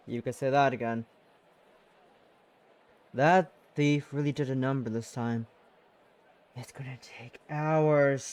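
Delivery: colleague lightly voiced with a French accent, low. Colleague grumbles about the mess in a French accent. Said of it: "that's French".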